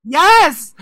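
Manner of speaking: high, very loud exclamation; emphatic shout. Real, not acted. Confirming the embarrassing detail to a friend, emphatic shout. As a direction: belt it out in real exasperation.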